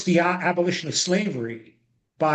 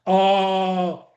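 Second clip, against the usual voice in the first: normal talking voice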